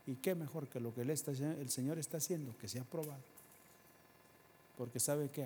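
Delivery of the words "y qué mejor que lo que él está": ordinary delivery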